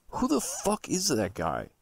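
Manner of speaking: ordinary delivery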